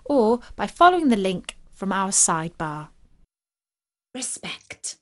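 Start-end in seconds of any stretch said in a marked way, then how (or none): 4.1-5.0 whispered respect. Acted. Lioness queen savours the word.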